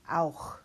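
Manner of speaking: auch whispered with a rough ach sound at the back; teacher contrasts ich and auch for students; ach laut demo. Shown as a demonstration. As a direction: breathe auch with a throaty ch. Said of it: "I want you to hear the difference".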